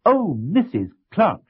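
a touch of excitement; excited greeting. Acted. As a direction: greet her friend with eager delight. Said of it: "Missus Dumpling was very excited".

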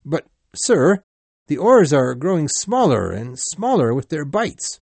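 pleading crewman, pitch swinging up; alarmed protest. A narrator's reading. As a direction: protest urgently to your captain. Crewman protests to his captain.

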